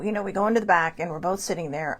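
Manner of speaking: ordinary delivery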